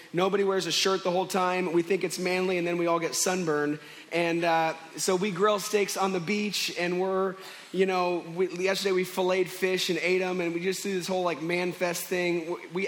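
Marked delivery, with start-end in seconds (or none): none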